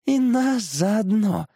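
slow, high, creaking tree voice; creaky little fir. A narrator's reading. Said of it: "проскрипела ёлочка". (скрипучая ёлочка, высоко и медленно)

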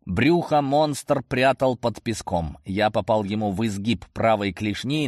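ordinary delivery, nothing marked

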